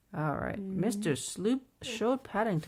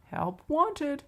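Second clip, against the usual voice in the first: higher, announced shop sign; sign read aloud; 9 semitones higher, 5 dB louder than usual. A narrator's reading. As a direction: lift it up like reading out a notice.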